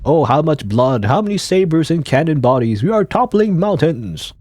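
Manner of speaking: loud, high stage oration; hammed-up war speech; dramatic actor. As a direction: proclaim it to the whole hall. Reads a dramatic actor's line.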